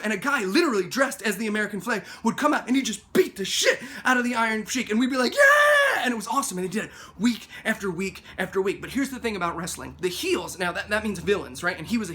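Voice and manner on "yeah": very high, loud drawn-out whoop; mock crowd cheer. Fans watch the villain get beaten, imitated wild cheer. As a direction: cheer like a roaring wrestling crowd.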